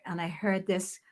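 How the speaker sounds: ordinary talk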